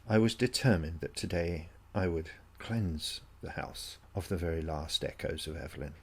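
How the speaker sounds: ordinary delivery, nothing marked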